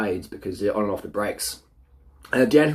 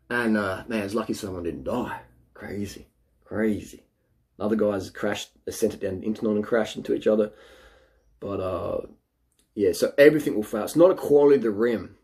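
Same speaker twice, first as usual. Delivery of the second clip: ordinary talk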